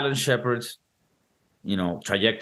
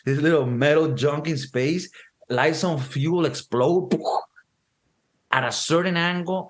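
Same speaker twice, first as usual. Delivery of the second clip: ordinary talk, nothing marked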